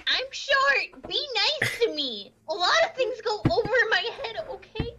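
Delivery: high-pitched plaintive protest, half laughing; mock whining. Teased short nurse asking for kindness, mock whining. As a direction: plead in a high, squeaky whine.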